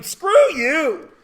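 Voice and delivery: high loud retort; mock angry outburst. Real, not acted. Teacher ranting at dog owners, loud retort. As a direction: fire it back.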